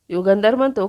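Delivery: usual speaking voice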